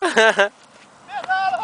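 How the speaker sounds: high, very slow stretched call; drawn-out cheer. Pretend. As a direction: stretch Pedala out like a commentator. Calling a soccer move commentator-like. (grito esticado)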